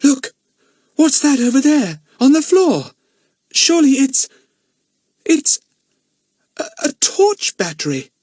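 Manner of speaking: put-on very high, loud excited girl; girl spotting something on the floor; astonished cry. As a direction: cry out in surprise, stumbling. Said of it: "gave an astonished cry".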